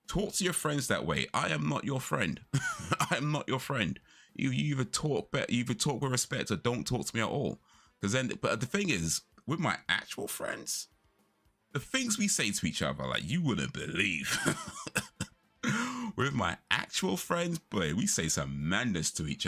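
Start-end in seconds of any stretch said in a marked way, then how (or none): 0.1-7.6 emphatic protest, pitched up; retold indignant telling-off. Retelling how he told off a coworker, higher indignant voice. 14.4-15.2 amused laugh. Real, not acted. Thinking of banter with real friends, high amused laugh.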